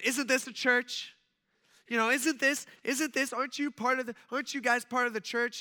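high, accusing imitation of a complainer; high-pitched, indignant challenge; mock indignation. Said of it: "she starts going".

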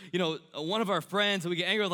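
usual speaking voice

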